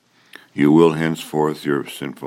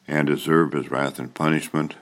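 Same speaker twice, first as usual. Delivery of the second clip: ordinary talk, nothing marked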